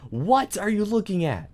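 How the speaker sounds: high, loud challenge; mock confrontation. Player challenging the game creature, high loud mock outrage.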